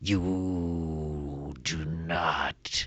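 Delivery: slow whispered menace; threatening whisper. Acted. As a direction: hiss the warning very slowly. Kobold threat.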